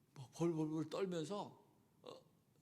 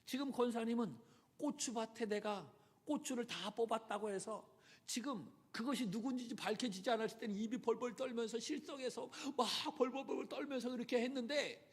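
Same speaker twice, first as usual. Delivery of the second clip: ordinary talk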